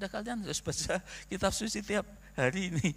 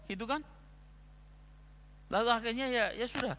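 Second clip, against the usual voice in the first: usual speaking voice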